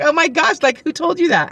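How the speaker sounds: high-pitched, astonished retelling; excited. Retold or imitated. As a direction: imitate her thrilled astonishment. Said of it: "she was all excited".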